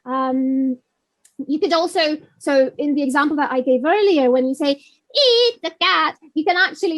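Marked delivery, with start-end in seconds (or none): 4.7-6.2 slow, drawn-out and higher; different voice. Shown as a demonstration. Teacher showing learners different voices, slow put-on voice. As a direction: stretch it out in a playful put-on voice. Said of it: "do it with different voices".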